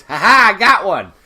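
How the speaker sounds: loud, high gleeful crow; hammed-up triumphant shout; drunken triumph. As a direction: shout it in mock victory, laughing. Drunk Stacy's triumph, acted.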